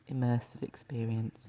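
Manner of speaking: ordinary delivery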